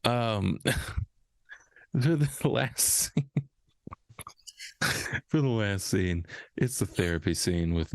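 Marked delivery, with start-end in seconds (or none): 0.7-3.3 words broken up by real laughter, very slow; can't get it out for laughing; laughing through words. Real, not acted. Restarts intro after laughing fit.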